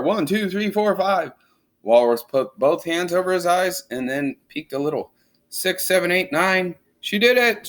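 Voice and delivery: high, building excited count. Acted. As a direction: count the cars with rising thrill. Crowd counting jumps.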